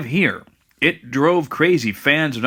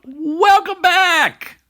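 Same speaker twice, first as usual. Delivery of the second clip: high, drawn-out; 13 semitones higher, much slower than usual. Acted. Co-host echoes the welcome.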